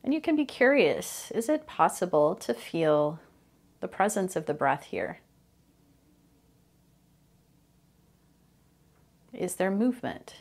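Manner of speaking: calm, unhurried meditation guide with long pauses; guided meditation. Real, not acted. Host guiding listeners through mindfulness of breathing, calm guided meditation. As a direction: ease listeners into the breath, phrase by phrase.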